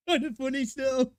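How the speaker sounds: words broken by high laughs; laughing. Real, not acted. Host repeating a joke while cracking up with laughter.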